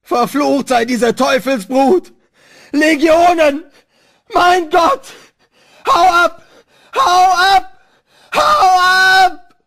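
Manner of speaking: very high, all-out screaming; swelling horrified roar. A narrator's reading. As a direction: shriek in utter terror, drawing words out. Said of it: "allmählich zu einem Gebrüll anschwoll".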